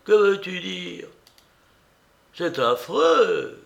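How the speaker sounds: high, loud, drawn-out outcry; exclaiming. A narrator's reading. Aunt throwing her bonnet down, dismayed exclamation. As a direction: cry out in dismay, stretching the end. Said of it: "s'écria-t-elle".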